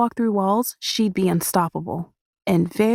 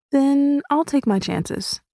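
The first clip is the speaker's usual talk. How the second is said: light; 7 semitones higher, slower than usual. A narrator's reading. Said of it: "I say lightly".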